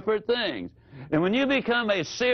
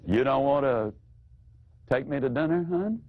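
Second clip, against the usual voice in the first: lower voice, rising question. Shown as a demonstration. Wife repeating husband's refusal, low voice rising. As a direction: gently toss it back to him. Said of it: "You just simply repeat what he said".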